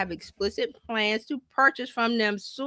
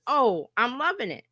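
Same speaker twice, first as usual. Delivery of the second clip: ordinary delivery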